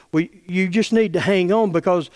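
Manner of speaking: ordinary delivery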